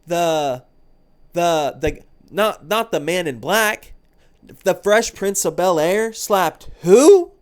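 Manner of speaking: loud, high, slow disbelieving cry; incredulous disbelief. Pretend. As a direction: shout it in utter disbelief. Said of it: "I'd be like".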